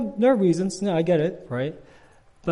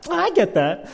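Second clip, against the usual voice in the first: mock agreement; 13 semitones higher, 8 dB louder than usual. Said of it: "I was like".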